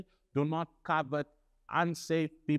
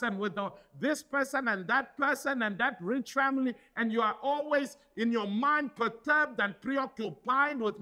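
rising, fervent preacher's cry; preaching at full force; 9 semitones higher than usual. Acted. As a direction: drive the message home to the congregation. Preaching builds.

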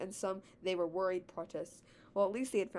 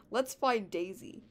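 ordinary delivery

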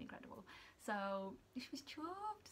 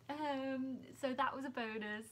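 ordinary delivery

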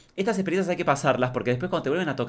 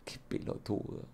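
soft, slow, unvoiced "Qué pelotudo" said with contempt; deadpan whispered put-down; unimpressed murmur; 15 dB quieter, slower than usual. Shown as a demonstration. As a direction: breathe it out, totally unbothered. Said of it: "para mirarlo y decirle".

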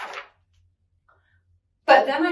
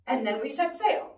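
usual speaking voice

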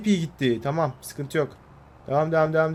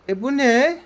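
high, loud shocked "E bu ne?"; sudden astonished yelp; startled surprise; 10 semitones higher, 5 dB louder, slower than usual. Real, not acted. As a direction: blurt it out in disbelief. Tractor does something odd.

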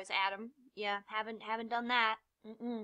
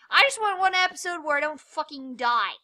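louder, higher frustrated complaint; frustrated outburst; 5 semitones higher, 10 dB louder than usual. Real, not acted. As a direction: blurt it out loudly, fed up. Frustrated at dying in game.

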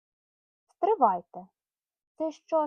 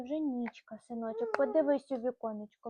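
plain reading voice

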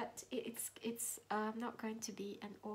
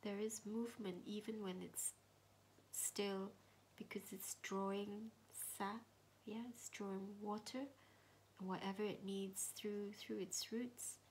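meditation whisper; 3 semitones lower, 5 dB quieter than usual. Acted. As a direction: whisper gently, guiding inward.

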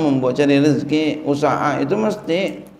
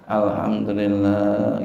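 calm content thanks; grateful; 5 semitones lower, slower than usual. Shown as a demonstration. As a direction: say it slowly, gently grateful. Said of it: "Kalau panas".

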